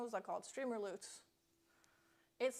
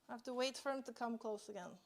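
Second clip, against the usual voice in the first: ordinary talk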